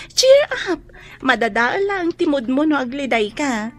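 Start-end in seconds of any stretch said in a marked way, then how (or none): 0.1-0.8 high, loud "cheer up"; bright cheer. Acted. Friend cheering up sad Dennis, high bright call. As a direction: lift his spirits with a bright call.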